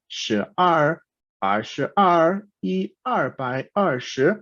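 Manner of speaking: "shi er, er shi er, er bai er shi" as careful Mandarin syllables; Mandarin pronunciation. Shown as a demonstration. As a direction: model "er" and "shi" slowly in Mandarin. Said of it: "se pronuncian".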